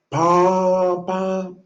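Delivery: high, nasal-free mock trumpet call pam pam; trumpet blast imitation. Telling of angels blowing trumpets, imitating the trumpet blast. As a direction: blare it like a heavenly horn.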